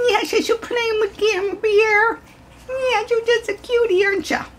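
very high coo at a puppy. Real, not acted. Sustained baby talk.

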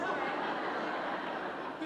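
a short real laugh through the word; laughing okay. Real, not acted. Teacher laughing at her students' joke, amused laugh.

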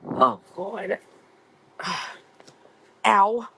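pained, hurting back. Real, not acted. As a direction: wince through sore back pain. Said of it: "Ow, my back".

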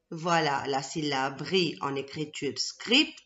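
ordinary delivery

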